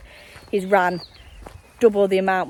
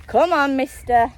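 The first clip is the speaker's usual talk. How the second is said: calling the dog; 7 semitones higher, 5 dB louder than usual. Real, not acted.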